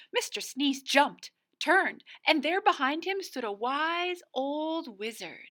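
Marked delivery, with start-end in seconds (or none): none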